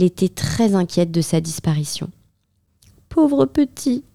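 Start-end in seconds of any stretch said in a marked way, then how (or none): 3.1-4.0 a fond mother fretting; worried mother. A narrator's reading. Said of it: "se disait-elle".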